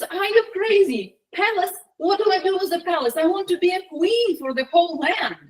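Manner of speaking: high-pitched indignant rant; acted shrewish outburst, pitch held high. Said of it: "the wife even more angry".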